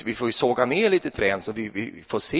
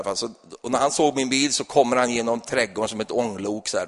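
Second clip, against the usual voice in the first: normal talking voice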